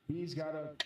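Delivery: normal talking voice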